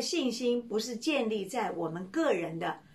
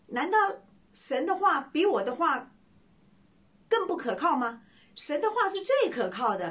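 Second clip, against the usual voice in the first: higher, louder preaching emphasis; preaching pastor; 5 semitones higher, 3 dB louder than usual. Acted. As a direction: drive home that God's word is sure. Pastor preaching to young man.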